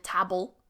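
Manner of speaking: "table" with a short a; mispronounced table. Shown as a demonstration. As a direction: say "table" to rhyme with "babble". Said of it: "the person has mispronounced the word table".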